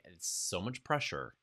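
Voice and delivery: ordinary delivery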